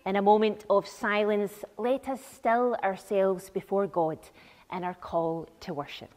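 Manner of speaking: ordinary talk, nothing marked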